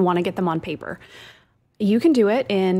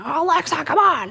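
higher, exasperated yell at the smart speaker; mock frustrated yell; 7 semitones higher than usual. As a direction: shout at Alexa like she's failing you again. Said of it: "like, screaming".

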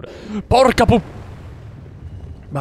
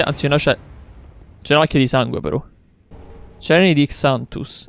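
normal talking voice

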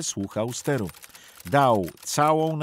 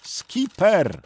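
high, loud cry for help; desperate shout; 7 semitones higher, 7 dB louder than usual. A narrator's reading. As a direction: yell it with all the air in his lungs. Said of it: "Sternik płynął i krzyczał, ile sił miał w płucach". (rozpaczliwy krzyk z całych sił)

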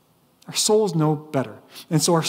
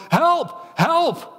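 high-pitched cry for help; comic, high cry of alarm; mock yell for help; 11 semitones higher than usual. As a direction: yelp it out like a panicked man, without full volume. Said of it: "and he yells".

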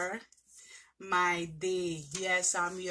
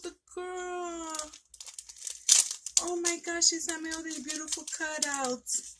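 excited gushing; 8 semitones higher than usual. Real, not acted. Admiring cutouts from friend.

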